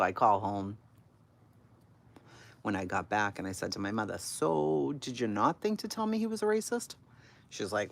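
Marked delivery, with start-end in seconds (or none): 4.2-6.9 high-pitched, pointed mock complaint; retold accusing question. Daughter confronting her mother by phone, accusing. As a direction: demand an explanation, pitched way up.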